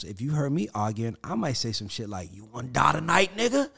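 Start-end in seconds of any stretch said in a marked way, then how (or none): 2.3-3.7 louder menacing snarl rising at the end; imitating his own angry threat in an argument; mock angry threat. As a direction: threaten like you're ready to fight. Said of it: "I'm mad".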